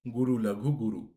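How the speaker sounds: usual speaking voice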